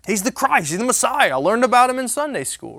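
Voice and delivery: loud, high shouting friend; booming, high confident shout; Jimbo's loud voice. Retold or imitated. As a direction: shout it proudly, like a loud buddy who knows his Sunday school. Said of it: "He's, he's, he's a little loud".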